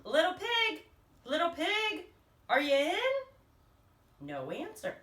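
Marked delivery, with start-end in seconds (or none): 0.0-3.0 raised, higher call at the pig's door; sing-song call, louder and higher. Acted. Wolf calling to the pig through the door, raised voice. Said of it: "so I called".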